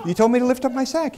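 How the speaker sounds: very high-pitched defensive reply; bewildered protest. Retold or imitated. Confused prisoner answers lance corporal, high bewildered protest.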